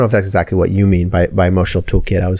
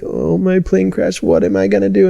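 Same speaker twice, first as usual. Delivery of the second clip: hammed-up despair, ready to curl up; helpless panic; 11 semitones higher than usual. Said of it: "Instead of this".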